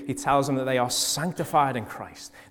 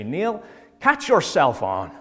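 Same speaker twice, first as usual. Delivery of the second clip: put-on dad's Northern Irish accent, slow and raised; 4 semitones higher, 4 dB louder, slower than usual. Dad telling his growing son off, Northern Irish accent. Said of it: "apologies for the accent".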